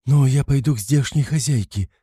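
high, mildly insistent mother; a touch of stubborn pleading. A narrator's reading. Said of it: "настаивала Пульхерия Александровна".

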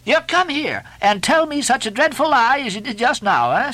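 high mock-preaching reproof; Stubb, accusing mate. A narrator's reading. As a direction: shame the cook in a high, emphatic voice.

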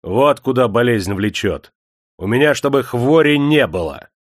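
громкая, выше, грозная; пригрози рабочим во весь голос; угроза. A narrator's reading. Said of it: "Никита пригрозил рудокопщикам". (громкая угроза рудокопам)

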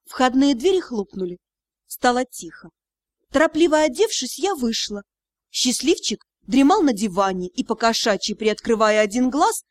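ordinary delivery

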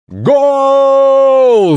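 very high, drawn-out goal yell; goal shout. A narrator's reading. Little monster scoring, high triumphant goal shout. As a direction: scream it like a commentator at a goal. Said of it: "закричал монстрик".

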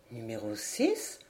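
very low, soft, slow question; puzzled echo. A narrator's reading. Steward echoing the stranger's cabin number, puzzled echo. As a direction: repeat it slowly and quietly, rising at the end.

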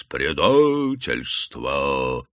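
very drawn-out low deacon's bass; бас дьякона. A narrator's reading. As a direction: intone it slowly like a deacon. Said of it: "Дьяконов бас". (протяжный дьяконский бас)

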